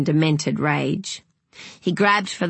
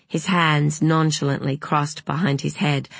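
plain reading voice

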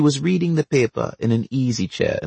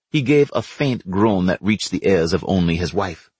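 ordinary delivery

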